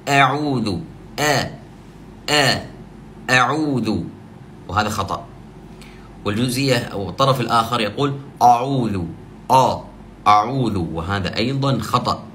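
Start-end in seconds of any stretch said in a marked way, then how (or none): 0.1-4.0 أعوذ said with the hamza thinned too far, like "e'udhu"; mock wrong reciter thinning the hamza; over-thinned hamza. Shown as a demonstration. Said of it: "يبالغ في ترقيق الهمزة". 8.4-10.8 mock wrong reciter thickening the hamza; over-heavy hamza. Shown as a demonstration. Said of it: "يبالغ في تفخيم الهمزة".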